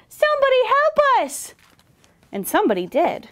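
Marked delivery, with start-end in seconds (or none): 0.1-1.5 high, desperate shout; frightened high-pitched cry; crying for help. A narrator's reading. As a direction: call out in storybook panic. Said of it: "they cried".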